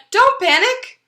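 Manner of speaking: very high, loud cry; shrill loud reassurance shout. Acted. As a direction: yell it to stop the panic. Chicken calms Chicken Little loudly.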